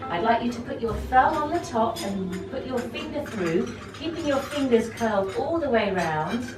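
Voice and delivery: normal talking voice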